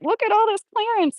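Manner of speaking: thrilled at the clearance rack, pitch way up; excited shopper. Retold or imitated. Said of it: "This is me being like".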